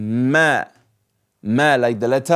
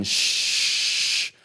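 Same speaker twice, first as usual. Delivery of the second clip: soft unvoiced shh; rain shush; 6 dB quieter than usual. Shown as a demonstration. Teacher imitating rain falling on the sea. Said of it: "So it's raining".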